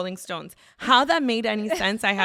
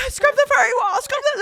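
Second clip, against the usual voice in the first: laughing frantic high voice; 8 dB louder than usual. Retold or imitated. Panicked on adrenaline after EpiPen.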